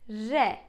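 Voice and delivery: "Re" with a light Turkish R; "Re", the R not too strong; soft Turkish R. Shown as a demonstration. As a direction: say "Re" without a strong American R. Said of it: "say it like that".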